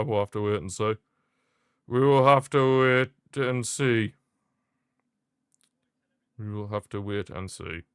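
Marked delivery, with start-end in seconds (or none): 1.9-4.1 slow, weighty, teasing delivery; drawn-out. Real, not acted. Streamer teases the World Cup final, slow and weighty. Repeats phrase for effect. 6.4-7.8 soft, low murmured repeat; hushed. Real, not acted. Streamer trails off quietly about the final.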